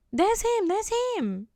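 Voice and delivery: high, urgent imitation of the girl; mock high excited voice. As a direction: squeal it like she just spotted him. Girl pointing out the man.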